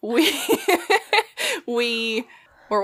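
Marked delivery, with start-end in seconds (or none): 0.3-1.6 high giggle. Real, not acted. Laughing at the memory.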